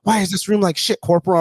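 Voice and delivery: high-pitched imitation of an officer's demand; mock reprimand. Commanding officer to corporal during room inspection, mock indignant reprimand. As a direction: demand an answer, pitched well up.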